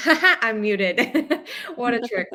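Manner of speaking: laughing at herself while talking. Real, not acted. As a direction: giggle through the words. Laughing at being muted.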